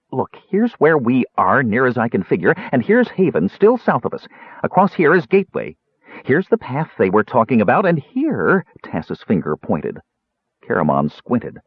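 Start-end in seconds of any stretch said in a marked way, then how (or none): 0.1-8.7 high, eager explainer; urgent Tass. A narrator's reading. Tass showing Caramon the map, urgent. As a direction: point it all out excitedly, pitched up.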